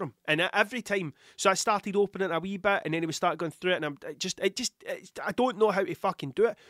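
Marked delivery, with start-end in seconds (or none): none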